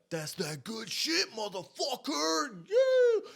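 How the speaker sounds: high, slow, drawn-out holler building to a scream; hyped mimicked yell. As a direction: imitate a wildly amused friend yelling. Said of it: "He starts to die laughing".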